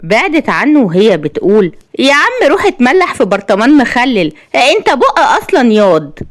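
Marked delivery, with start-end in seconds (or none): 2.0-6.2 high-pitched sassy put-down; cheeky insult. A narrator's reading. Woman mocks the man complaining about her, cheeky. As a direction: fling it high, with attitude.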